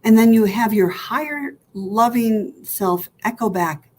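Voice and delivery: ordinary talk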